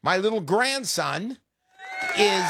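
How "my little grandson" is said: ordinary talk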